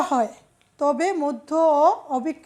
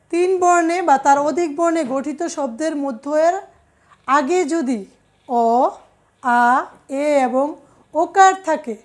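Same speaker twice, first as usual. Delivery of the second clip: usual speaking voice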